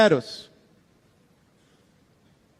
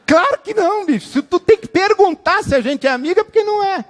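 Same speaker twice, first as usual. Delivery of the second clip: blunt retort; 9 semitones higher, faster than usual. Retold or imitated. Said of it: "deu vontade de dizer assim".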